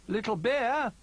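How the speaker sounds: high, loud searching call; storybook character calling a name. A narrator's reading. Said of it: "called Bramble".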